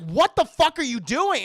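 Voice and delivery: dad yelling high and loud in shock; shouted dad. Acted. Husband finding wife's grisly miniature, loud shocked yell. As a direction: bellow it at her, aghast. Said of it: "And the dad's like".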